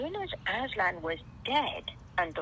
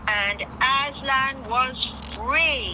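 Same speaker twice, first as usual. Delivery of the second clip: 1 triumphant reveal; 5 semitones higher, 5 dB louder, slower than usual. A narrator's reading. Climax of story narration.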